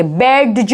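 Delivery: बैज said as written, "bed-juh"; spelling pronunciation demo. Shown as a demonstration. Teacher showing the spelling trap, mispronounced. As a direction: sound the d before j in बैज. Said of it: "लिखा हुआ है ऐसा नहीं".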